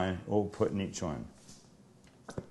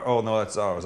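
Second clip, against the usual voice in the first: normal talking voice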